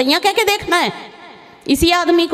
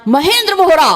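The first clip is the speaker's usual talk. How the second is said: calling out; 3 semitones higher, 4 dB louder than usual. Retold or imitated. Calling out on a hilltop.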